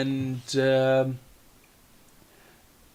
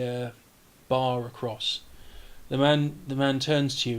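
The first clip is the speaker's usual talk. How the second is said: usual speaking voice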